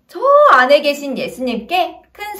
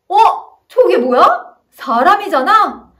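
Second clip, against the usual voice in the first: shocked; 4 semitones higher, 6 dB louder than usual. Acted. Crowd shocked at man lowered.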